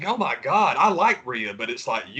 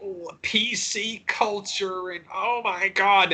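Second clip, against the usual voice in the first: high, slow whiny mockery; mocking whiners; 5 semitones higher, much slower than usual. Podcaster mocking online fans, slow whiny high voice. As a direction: drag it out like a whiner. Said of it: "people whine so much about".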